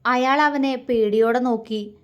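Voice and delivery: ordinary delivery, nothing marked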